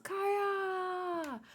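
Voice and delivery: high, drawn-out cry of the name; long drawn-out shout. Acted. Calling to Kaya in mortal danger, long high shout.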